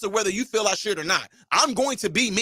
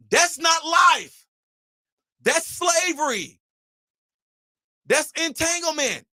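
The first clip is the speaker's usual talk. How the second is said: emphatic shout; 4 semitones higher, slower than usual. Real, not acted. Outburst against critics.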